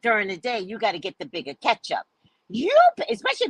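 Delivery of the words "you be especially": jumps way up and louder on You; sudden high burst. Real, not acted. Scolding her man, sudden high burst. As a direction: breaks off high, exclaiming.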